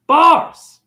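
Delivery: high, loud punchline shout; shouted catchphrase. Acted. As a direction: yell it like a mic drop. Comedic catchphrase exclamation.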